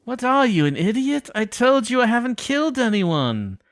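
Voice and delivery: high, exasperated retort; indignant suspect. Acted. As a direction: scoff at the cop, pitched high. Suspect snapping at detective.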